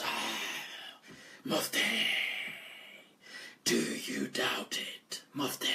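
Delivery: low, slow eerie whisper; possessed jinn whisper. Retold or imitated. Possessed man whispers to the mufti, slow and eerie. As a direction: breathe it out like a spirit speaking through a man. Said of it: "And he speaks like this".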